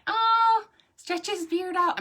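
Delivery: high, loud delighted oh; high playful exclamation. Acted. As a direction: tease the puppet gleefully. Playful puppet beard stretch.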